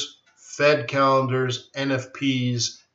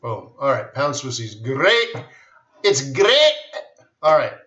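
ordinary delivery

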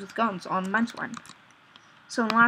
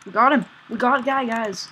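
excited; 3 semitones higher, 8 dB louder than usual. Real, not acted. Celebrating a kill in game.

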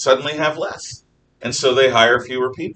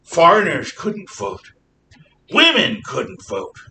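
slow, louder mock scolding historian; 5 semitones higher, 4 dB louder, slower than usual. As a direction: parody a preachy historian, drawn out. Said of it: "They say it in this exact tone".

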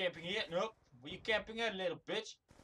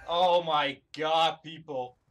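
exasperated; 5 semitones lower, 6 dB louder, slower than usual. Real, not acted. Player exasperated by the game.

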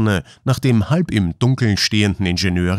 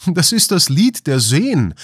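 higher, excited exclamation; Ausruf; 7 semitones higher than usual. A narrator's reading. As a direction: call it out in sudden recognition. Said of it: "rief Harry". (aufgeregt ausgerufen)

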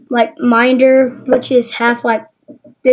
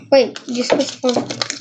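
ordinary delivery

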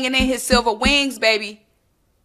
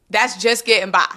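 normal talking voice

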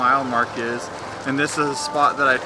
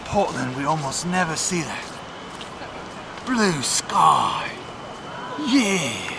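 put-on Australian accent; 5 dB quieter, slower than usual.

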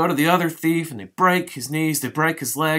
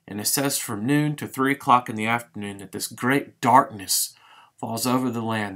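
normal talking voice

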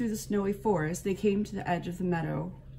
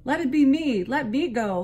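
high, loud eager plea; eager fawn; 7 semitones higher, 8 dB louder than usual. A narrator's reading. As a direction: beg to be picked, eagerly. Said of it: "volunteered the fawn".

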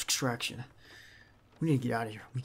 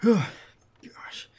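exhausted whisper; 9 semitones higher, 8 dB louder than usual. Acted. Exhausted after the shootout.

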